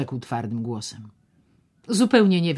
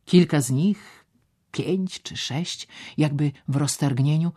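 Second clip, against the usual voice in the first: ordinary delivery, nothing marked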